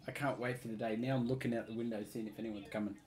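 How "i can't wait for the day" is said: "I can't wait for the day" repeated softer, as if to himself. Real, not acted. Repeating to his friends that he can't wait, softly to himself. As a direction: drop "I can't wait for the day" to an eager murmur. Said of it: "Oh, I can't wait for the day".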